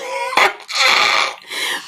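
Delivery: high, breathy cackle of amusement; laughter. Real, not acted. Laughs at a chat comment.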